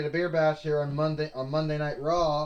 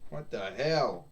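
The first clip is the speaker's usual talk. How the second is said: ordinary talk, nothing marked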